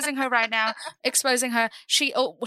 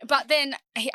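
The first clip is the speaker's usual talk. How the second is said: ordinary delivery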